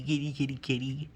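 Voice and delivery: ordinary talk, nothing marked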